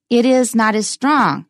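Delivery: ordinary talk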